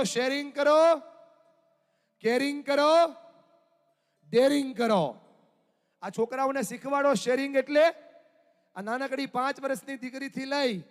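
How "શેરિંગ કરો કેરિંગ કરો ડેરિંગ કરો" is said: slow, forceful shout of the chant; rallying the audience to shout back loud; loud call-out. Shown as a demonstration. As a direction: lead the crowd louder. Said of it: "જોરથી બોલો".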